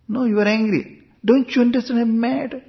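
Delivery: high-pitched mock bickering; acted exasperated argument. Acted back-and-forth misunderstanding over mad.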